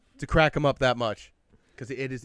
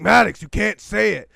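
raised, higher voice, telling a friend off; retold scolding; 7 semitones higher, 10 dB louder than usual. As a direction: loudly scold your buddy mid-story.

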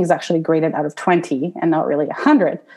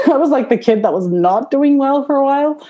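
high, laughing through the words; self-mocking laughter; laughing while talking; 9 semitones higher than usual. Real, not acted. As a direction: laugh at your own past. Laughing about failing at school.

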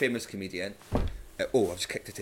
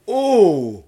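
high loud scandalised Oh!; loud mock gasp of an offended crowd; mock shocked gasp; 11 semitones higher, 16 dB louder than usual. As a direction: imitate a shocked audience gasping Oh!. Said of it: "They'd hear the word punched and go".

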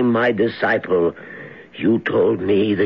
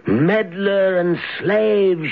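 giantess voice; 8 semitones higher, slower than usual. Acted. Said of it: "she said".

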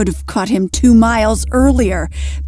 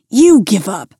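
louder grunted demand mid-wrestle; 5 dB louder than usual. Acted. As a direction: grunt it while straining. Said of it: "Lyle grunted".

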